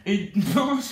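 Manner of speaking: loud, slow, spitting the p's; spray the p sounds hard; spitting while talking. Shown as a demonstration. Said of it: "tu vas souffrir".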